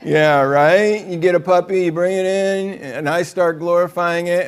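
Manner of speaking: ordinary delivery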